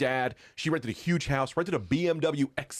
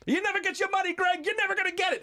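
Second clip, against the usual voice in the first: much higher put-on voice, hammed up; Jimmy Smits impression; faster than usual. Jimmy playing along through the door, high mock taunt. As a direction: squeak it out like a teasing imitation. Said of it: "Jimmy's like".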